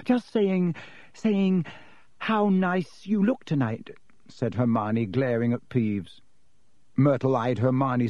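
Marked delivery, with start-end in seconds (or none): 0.0-3.9 higher, hasty false compliment; flustered cover-up. A narrator's reading. Girl covers up before the ghost, flustered. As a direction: stumble into a too-sweet compliment.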